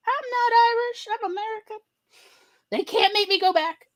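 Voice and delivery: high, wavering mock voice of an old grandmother, close to tears; Grandma Marty's voice. Grandchild imitating Grandma Marty insisting she is American, high and teary. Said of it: "And she was like".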